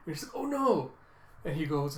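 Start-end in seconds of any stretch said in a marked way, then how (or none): 0.4-0.9 high-pitched mock alarm; play-acted oh-no; dismayed. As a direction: cry it out like a dismayed kid. Acted child dismayed at mistake.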